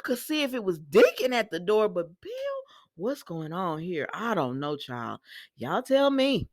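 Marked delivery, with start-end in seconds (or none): none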